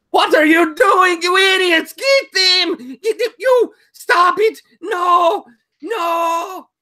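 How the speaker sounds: shrill high screaming fury; furious shout. Acted. Lucia screams at the nut men, high furious shriek. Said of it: "you hear Lucia go".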